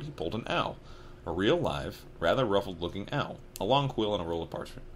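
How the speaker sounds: ordinary delivery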